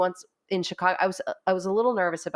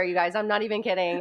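ordinary talk, nothing marked

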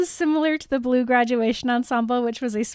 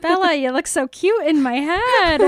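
higher, louder gush of delight; 5 semitones higher, 5 dB louder than usual. Real, not acted. Cohost picturing Bella's wedding outfit, gushing. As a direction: gush over how cute she looks.

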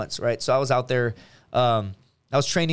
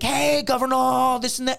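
hammed-up friend greeting; 10 semitones higher than usual. Friend greeting him at the gym Monday, hammed-up hail. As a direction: sing out a mock greeting.

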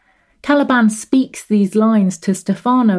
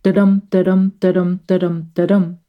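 "da-dum" with weak then strong beat; iambic da-dum. Shown as a demonstration. Lecturer showing students the iambic beat with "da-dum". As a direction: tap out "da-dum" as iambic meter. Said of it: "following a pattern of unstressed, stressed syllables".

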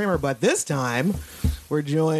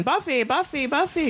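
mock yelling; 10 semitones higher than usual. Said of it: "the kids are all, like, yelling".

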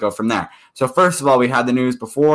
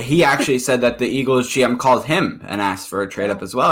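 normal talking voice

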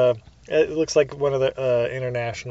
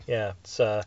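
ordinary delivery

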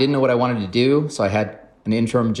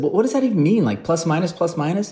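high, louder, baffled disbelief; incredulous question; 10 semitones higher, 3 dB louder than usual. Retold or imitated. Baffled new hire questioning the boss, high and incredulous. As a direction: squeak out your confusion at nonsense. Baffled by weird job jargon.

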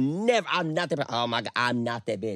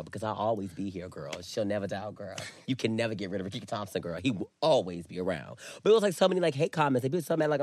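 usual speaking voice

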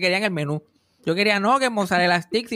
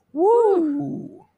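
mock cheer; 11 semitones higher than usual. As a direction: whoop like a fake-happy bride.

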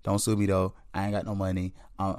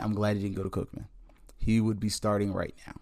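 usual speaking voice

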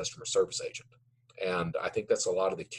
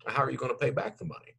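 fast probing questions; quick questions; 4 semitones higher, faster than usual. Retold or imitated. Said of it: "those other questions look like".

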